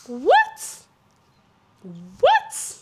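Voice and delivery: very high, loud squeal of a question; hammed-up shocked yelp; exaggerated disbelief. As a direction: squeak it out, totally baffled. Repeated disbelief at the answer.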